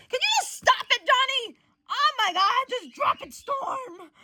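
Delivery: loud, high plea, easing at the end; exasperated. Acted. Friend telling Johnny to drop it, exasperated high yell. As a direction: beg them to quit, high and fed up.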